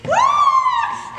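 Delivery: very high, loud lady's shriek. Retold or imitated. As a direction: scream like a frightened lady. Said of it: "that they would shriek".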